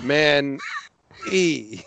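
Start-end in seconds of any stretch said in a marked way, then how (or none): none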